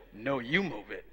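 the other voice. Retold or imitated. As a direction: answer back low and unbothered. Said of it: "they answer, they say".

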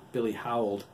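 ordinary delivery

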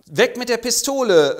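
high, loud barked order; mock police shout; shouted command. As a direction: stop him at gunpoint. Acted confrontation, gun drawn.

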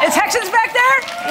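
high-pitched call to the crowd. Real, not acted. Speaker to Texans at the back of the room, calling out. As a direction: toss a high, eager question out to the back.

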